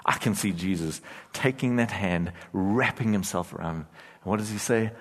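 ordinary talk, nothing marked

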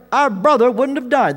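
hurt accusation flung at Jesus; blaming lament. Retold or imitated. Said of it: "They're blaming him".

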